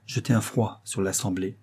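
plain reading voice

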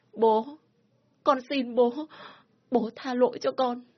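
high pleading; tearful plea. Acted. Daughter pleading with her father for forgiveness, tearful.

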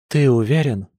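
mildly agitated question; anxious murmur. A narrator's reading. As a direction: seek reassurance with some nerves. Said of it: "раздался взволнованный шёпот". (взволнованно, негромко)

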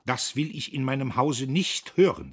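loud, serious household command; stern. A narrator's reading. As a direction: lay down the law sternly. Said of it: "sagte er ernst".

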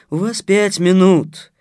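loud, slow official notice; impassive. A narrator's reading. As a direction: state the limit slowly and firmly. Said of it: "бесстрастно проговорила крокодил в юбке".

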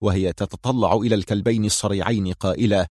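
plain reading voice

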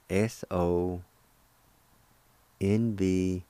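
careful, slow spelling for learners; slow letter-by-letter spelling. Shown as a demonstration. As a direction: spell each letter slowly, with long gaps. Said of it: "It's spelled like this".

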